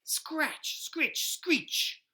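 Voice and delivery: high scratchy screech sounds; high eerie screeching sound effect; screeching noise. Acted. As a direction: make the creepy window noise. Scary noise at the window.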